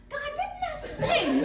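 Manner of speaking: high, slow, laughing exasperation; exasperated laugh. Retold or imitated. To God as he pulls up, high laughing exasperation. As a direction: throw it up to God in laughing disbelief.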